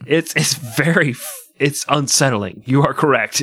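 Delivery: ordinary talk, nothing marked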